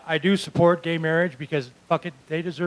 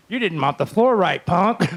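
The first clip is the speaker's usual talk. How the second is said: loud, slow, higher scolding; mock bullying; 5 semitones higher, 5 dB louder, slower than usual. Boss scolds worker over the floor, mock-bullying. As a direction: play the punishing tough, loudly. Acted workplace punishment.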